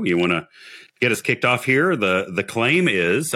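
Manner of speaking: normal talking voice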